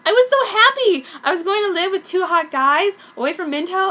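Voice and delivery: giddy. Acted. Heroine thrilled to move in with two hot guys. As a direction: bubble over with joy. Narrator gushing.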